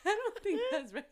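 laughing. Real, not acted. Cracking up at friend.

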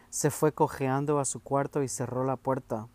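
plain reading voice

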